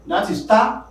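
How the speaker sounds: ordinary talk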